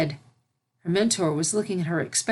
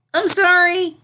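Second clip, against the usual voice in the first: high, loud panicked sorry; a small filly's shrill panicked apology; frantic apology; 12 semitones higher, 7 dB louder than usual. Acted. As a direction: blurt it out in a fright. Filly apologizes after splashing.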